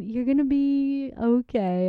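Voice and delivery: normal talking voice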